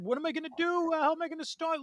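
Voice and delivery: very high, frantic inner panic; mock panicked worrying; panicked thoughts. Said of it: "I'm thinking in my head".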